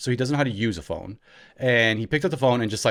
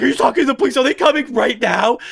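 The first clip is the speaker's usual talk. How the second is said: high, loud, urgent fake alarm; mock panic; 11 semitones higher, 6 dB louder, faster than usual. Brother running in to join the fake police call, mock panic. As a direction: play up the panic for a frightened kid. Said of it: "Full on".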